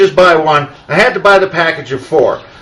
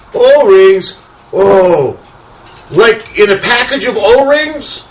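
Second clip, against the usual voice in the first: high, slow, puzzled mock clerk voice; dopey counter kid; 5 semitones higher, much slower than usual. Retelling the clueless parts counter kid, slow high puzzled voice. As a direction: play the clueless kid, drawn out. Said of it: "He goes".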